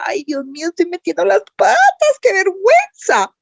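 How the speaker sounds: a flustered, high-pitched panic at herself; nervous inner voice. Retold or imitated. Dubbing newcomer scolding herself, high and flustered. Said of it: "yo decía".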